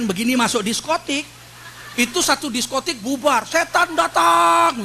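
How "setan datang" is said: slow, higher and louder, drawn out. Acted. Preacher joking that devils come for the disco, slow dramatic. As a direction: stretch it like a spooky reveal. Comic image of devils arriving.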